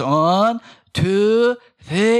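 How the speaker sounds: put-on loud toddler counting; imitating a toddler. Pastor imitating his two-year-old son counting loudly. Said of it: "he goes".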